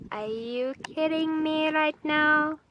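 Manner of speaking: normal talking voice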